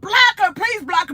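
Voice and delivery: high, loud mock screaming; hammed-up high yell at a moderator; imitated screaming. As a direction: shout it like a frantic, imitated plea. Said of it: "Pretty was screaming through the".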